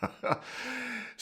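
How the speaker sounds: airy breathy chuckle; a light, real laugh at his own joke; soft laugh. Real, not acted. Speaker laughing at his teasing aside to Daniel, soft and airy.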